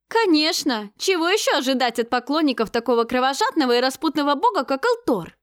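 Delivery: loud indignant cry; shouting. A narrator's reading. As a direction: shout it in outrage. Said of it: "кричала Елеоль".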